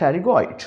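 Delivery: pterygoid said with a silent P, starting at the T. Shown as a demonstration. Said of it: "पी को खामोश कर देंगे".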